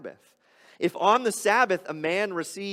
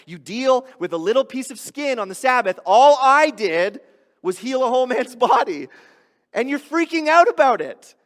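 much louder and higher, exasperated outburst; indignant rebuke; 9 semitones higher, 12 dB louder than usual. Acted. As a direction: let the frustration burst out at the crowd. Jesus rebuking the crowd, voiced.

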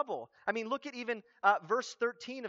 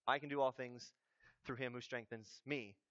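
low, soft throwaway quote; mock poster caption; 8 semitones lower, 10 dB quieter than usual. Preacher quotes a basketball poster's verse, low deadpan aside. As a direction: drop it low and quiet, trailing softer.

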